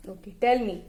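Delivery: ordinary talk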